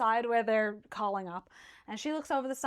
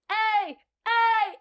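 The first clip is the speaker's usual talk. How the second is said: higher and louder hailing calls; calling up; 10 semitones higher, 6 dB louder than usual. Acted. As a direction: hail the ship from the water. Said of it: "They're going".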